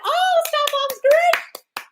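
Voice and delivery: very high, loud delighted squeal; excited squeal. Real, not acted. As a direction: celebrate at the top of your pitch. Celebrating a viewer's good news.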